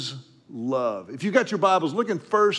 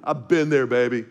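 normal talking voice

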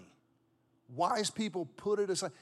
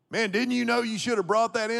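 higher and louder reproachful mechanic; scolding mechanic; 5 semitones higher, 6 dB louder than usual. Retold or imitated. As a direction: scold him like a know-it-all mechanic.

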